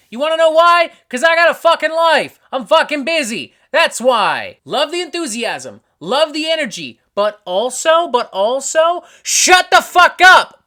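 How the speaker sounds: exasperated high, loud shouting at fans; mad-sounding rant. Acted. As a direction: yell back at the pestering, building to a full shout. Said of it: "sorry if it sounds like I'm mad at you".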